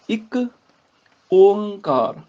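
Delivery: ਇੱਕ, pause, then open nasal ਓੰਕਾਰ. Shown as a demonstration. Teacher modelling the open-mouthed letter, ਓੰਕਾਰ with a nasal o. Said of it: "ਨਾਸਕੀ ਉਚਾਰਨ ਹੋਏਗਾ".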